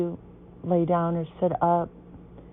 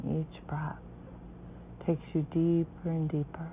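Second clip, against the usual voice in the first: guided meditation; 9 dB quieter than usual. Acted.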